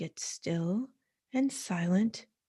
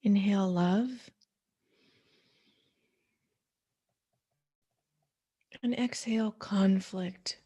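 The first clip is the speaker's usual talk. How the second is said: soothing guided meditation voice. Acted. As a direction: ease the listener into stillness, one breath cue at a time.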